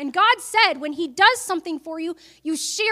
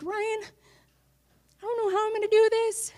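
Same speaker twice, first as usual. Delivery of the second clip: higher, slow, choked-up plea to Ryan; tearful plea; 6 semitones higher, 3 dB quieter than usual. Retold or imitated. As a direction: let it break like you're about to cry. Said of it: "I cried".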